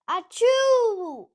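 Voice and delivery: very loud, high achoo; huge sneeze. Acted. Gerald sneezes at Piggy, huge and high. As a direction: blast out a giant sneeze.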